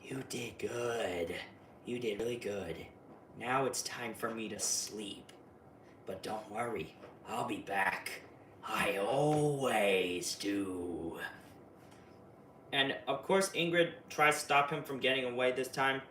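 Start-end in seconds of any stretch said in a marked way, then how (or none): 0.0-11.2 low, soft, very slow sinister praise; creepy low farewell; Pennywise voice. Retold or imitated. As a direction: hush it like a monster lulling a child. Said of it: "Pennywise says".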